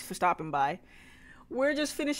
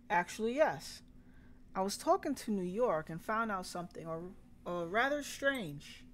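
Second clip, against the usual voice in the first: storytelling in a Southern twang; Southern accent. Acted. Telling of the sheriff's questions about Walter, Southern accent. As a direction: narrate and voice it as country folk, Southern-accented. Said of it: "when I put in that accent".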